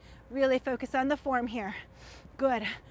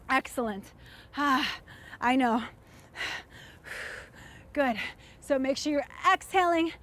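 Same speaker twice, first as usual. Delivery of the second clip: normal talking voice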